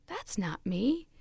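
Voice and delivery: hushed wonder, half whispered; awed surprise. Acted. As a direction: marvel at your own reflection. Teacup sees itself in mirror.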